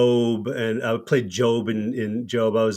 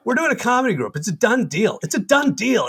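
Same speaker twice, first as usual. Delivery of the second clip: high, pushy, emphatic; insistent announcement; 9 semitones higher than usual. Retold or imitated. [name] tells Kevin the group is happening, high and pushy. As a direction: push it on him, high and insistent. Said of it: "And I'm like".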